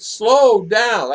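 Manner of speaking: loud, high retold command; loud order. Retelling how he told the speeding driver off loudly. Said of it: "I said".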